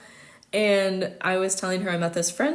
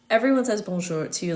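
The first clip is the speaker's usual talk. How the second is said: normal talking voice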